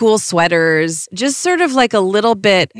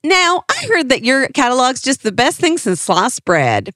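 put-on Southern accent; 6 semitones higher than usual. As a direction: do a kid's fake grown-up Southern accent. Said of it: "I would talk in an accent".

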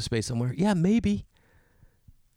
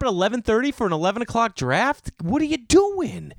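exasperated; 10 semitones higher, 4 dB louder than usual. Real, not acted.

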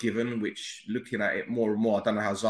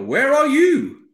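loud, high, drawn-out call; 13 semitones higher, 10 dB louder, much slower than usual. Real, not acted. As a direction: shout it across the room. Caller's bad audio, calling out.

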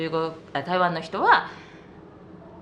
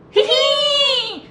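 high, slow drawn-out whinny; slow horse neigh; 6 dB louder, much slower than usual. Shown as a demonstration. Revealing the answer, a long high horse whinny. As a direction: stretch the neigh like a rearing horse. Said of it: "馬、馬です。".

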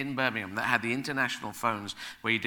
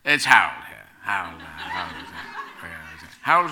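deliberately bad, lower put-on agent voice; bad agent impression; 7 semitones lower, 6 dB louder than usual. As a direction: fake a grand agent on the phone, pitched lower. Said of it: "a bad impression of him".

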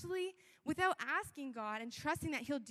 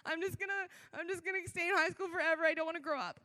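put-on whiny teen self; 3 semitones higher, 4 dB louder, faster than usual. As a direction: play your panicked grade twelve self, half joking. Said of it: "I'm like".

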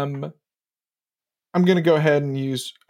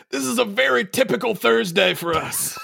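higher-pitched in-character quip; in-character aside; 7 semitones higher than usual. Acted. Player turning to the Rager in character, raised-voice quip. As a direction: toss it to a companion in a raised character voice. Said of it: "I turn to the Rager and I go".

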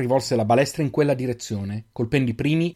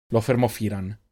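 plain reading voice

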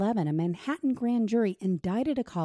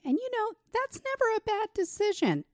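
feigned agreement, pitched way up; sarcastic; 13 semitones higher than usual. Real, not acted. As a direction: say it sarcastically in a much higher pitch. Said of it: "I say that sarcastically".

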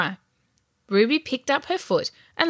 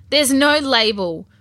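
slow, louder, higher contradiction; arguing; 5 semitones higher, 5 dB louder than usual. A narrator's reading. Ruby argues with her brother about the container, insisting. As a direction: insist on it, drawing the words out. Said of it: "Ruby argued".

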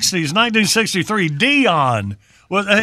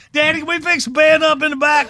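put-on higher childlike plea; kid voice; 7 semitones higher, faster than usual. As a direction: beg like a little kid. Kid voice in story.

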